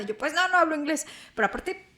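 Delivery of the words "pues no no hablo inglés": angry. Retold or imitated.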